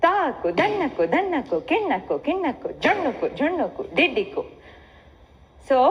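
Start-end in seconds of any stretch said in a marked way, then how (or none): 0.1-4.4 fast takitta recitation. Shown as a demonstration. Teacher shows the students a fast takitta recitation. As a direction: rattle the syllables off fast and higher, in threes. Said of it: "Now you need to do it in takitta".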